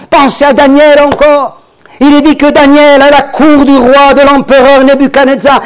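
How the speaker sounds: high, loud fervent preaching; preaching at full force. Acted. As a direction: lift the congregation, build to Alléluia.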